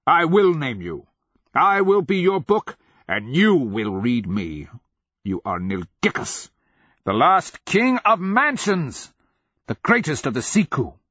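high, loud proclamation; ringing bellow with stretched words. A narrator's reading. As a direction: declaim grandly, swelling on the titles. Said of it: "he bellowed".

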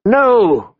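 high-pitched, restrained scream. A narrator's reading. Captive boy protesting the choice, mildly screamed. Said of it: "Bran screamed".

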